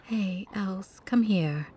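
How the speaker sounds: soft comforting whisper; tender whisper. Acted. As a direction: whisper it gently to soothe.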